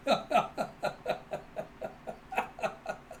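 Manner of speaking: high breathy laugh trailing off; real laughing fit. Real, not acted. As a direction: crack up and run out of air. Narrator cracks up.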